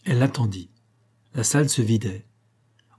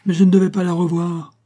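high, loud objection; agitated outburst; heated protest; 8 semitones higher, 6 dB louder than usual. A narrator's reading. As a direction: protest indignantly, pitch and volume up. Protests during the letter quarrel.

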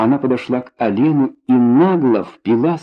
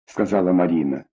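usual speaking voice

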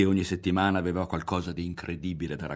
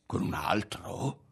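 slow, drawn-out incredulous question; stretched, dumbfounded question; stunned disbelief; slower than usual. A narrator's reading. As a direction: echo it in dazed shock, rising. Ario stunned by revelation.

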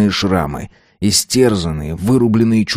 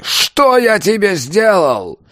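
much higher, slow raging scream; 12 semitones higher, much slower than usual. A narrator's reading. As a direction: scream until it breaks. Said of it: "заорал он".

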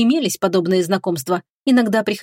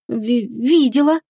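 slow, halting stammer; 4 semitones higher, much slower than usual. Acted. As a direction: trip over the first syllable. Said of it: "запнувшись".

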